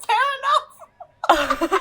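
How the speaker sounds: slow, high, laughing. Retold or imitated. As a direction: draw it out through a laugh. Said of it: "And I was like".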